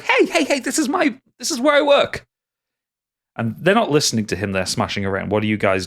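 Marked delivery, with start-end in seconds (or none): 0.0-2.3 much higher, flustered protest. Acted. Quattro protests to the guards smashing his bar, much higher. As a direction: squeal in panic as they wreck the place.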